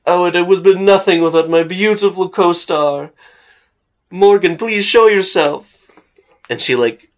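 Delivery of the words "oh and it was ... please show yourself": high, loud theatrical proclamation; pompous actor. Acted. Actor calls his hiding co-star out for applause, pompous. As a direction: present your co-star like a grand thespian. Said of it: "he's so pompous looking".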